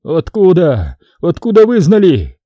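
high tearful question, rising in pitch; sobbing. A narrator's reading. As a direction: cry it out in dismay. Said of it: "всхлипнул поп".

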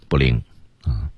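ordinary talk

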